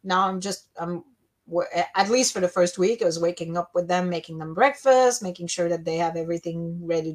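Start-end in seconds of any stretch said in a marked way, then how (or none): none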